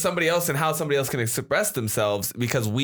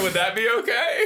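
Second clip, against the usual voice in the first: laughing quote; 8 semitones higher than usual. Retold or imitated. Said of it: "He was like".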